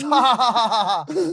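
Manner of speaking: put-on "ha ha ha" laughter; mock laughing. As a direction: imitate someone laughing at you, each "ha" loud. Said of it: "we'll just laugh at you".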